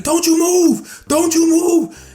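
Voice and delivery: high, loud mock yell; armed guard's order. Female guard aiming her gun at the intruder, shouted order.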